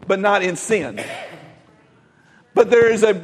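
ordinary talk

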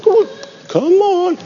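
high sweet coaxing "come on". Retold or imitated. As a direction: coax like calling a child. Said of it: "You'd be like".

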